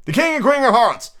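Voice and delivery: high, loud proclamation; all-caps announcement. Shown as a demonstration. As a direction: herald it in capital letters. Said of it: "It's all caps".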